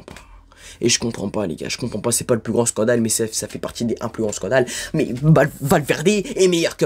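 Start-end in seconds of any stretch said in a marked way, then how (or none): none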